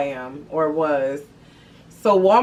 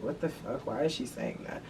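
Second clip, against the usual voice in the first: mock confused whisper; 6 semitones lower, 15 dB quieter than usual. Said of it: "they just like".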